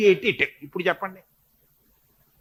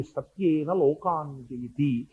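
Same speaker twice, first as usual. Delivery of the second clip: slow, soft scripture recitation; 6 semitones lower, 9 dB quieter, much slower than usual. Acted. Preacher quotes the verse to listeners in slow recitation. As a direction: recite the verse slowly and reverently.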